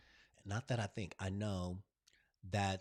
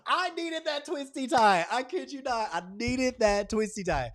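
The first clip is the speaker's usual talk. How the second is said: excited; 12 semitones higher, 8 dB louder than usual. Real, not acted. Emphatic retelling to viewers.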